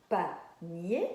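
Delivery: PANIER split into PA - NIER, pause between; syllable by syllable. Shown as a demonstration. Teacher showing children the first syllable, syllable by syllable. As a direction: break PANIER in two beats, second beat rising. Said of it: "Voilà, par exemple".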